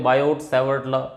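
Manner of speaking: "बायोट" ending in a sounded T; T pronounced. Shown as a demonstration. Teacher shows how some students say it, T pronounced. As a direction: say "बायोट" with the final T heard. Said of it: "कई बच्चे बायोट भी बोलते हैं".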